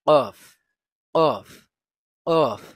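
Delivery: very loud কফ from the back of the tongue; deep qaf. Shown as a demonstration. Teacher drilling the letter কফ, very loud. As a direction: bark কফ from deep in the mouth. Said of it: "কফ উচ্চারিত হবে".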